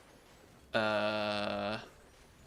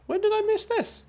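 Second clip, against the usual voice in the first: very high astonished squeal; surprised squeak; 3 dB louder than usual. Real, not acted. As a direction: squeal it in disbelief. Surprised by news post.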